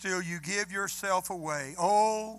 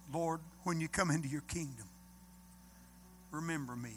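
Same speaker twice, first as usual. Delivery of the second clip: hushed plea; 3 semitones lower, 8 dB quieter, faster than usual. Retold or imitated. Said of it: "he turned to Jesus and he said".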